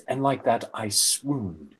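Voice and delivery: usual speaking voice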